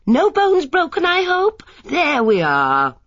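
a neighbour loudly fussing over Miss Marple; loud and friendly. Acted. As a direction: gush over a fallen old lady. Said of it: "Her voice was loud and friendly".

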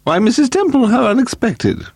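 high-pitched, stern society hostess; severe. A narrator's reading. Hostess greets Missus Temple who came up through basement, severe. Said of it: "Lady Delamore said severely".

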